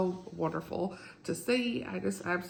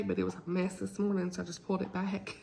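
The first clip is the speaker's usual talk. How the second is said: normal talking voice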